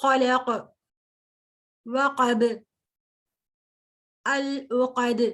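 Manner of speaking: خلق, وقب, العقد each ending on a bounced, echoing stop; teacher demonstrates the qalqalah bounce on verse endings. Shown as a demonstration. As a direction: let the last letter bounce on each word. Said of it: "یہ جو sound ہے".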